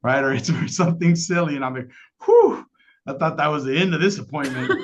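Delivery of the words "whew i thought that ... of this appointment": big high whew, then a fast laughing line; relieved laugh. Retold or imitated. Agent relieved the pill is harmless, laughing whew. Relieved after hearing medication's purpose.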